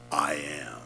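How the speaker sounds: hushed, breathy whisper of a sacred name. Acted. Preacher quoting Jesus, whispering I am. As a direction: drop to a reverent whisper.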